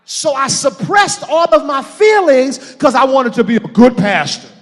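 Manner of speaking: loud, high preaching climax; full-force preaching. Real, not acted. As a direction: pour it out to the congregation, loud and high.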